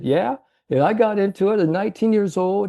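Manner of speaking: put-on a light take on the old salesman's way of talking. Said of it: "kinda talk to you, Chris, how he talked".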